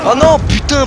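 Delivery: loud high dismayed yelp; frustrated cry. Real, not acted. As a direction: cry out at getting killed. Killed in game.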